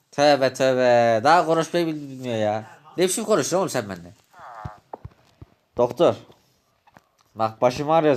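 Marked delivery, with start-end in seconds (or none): none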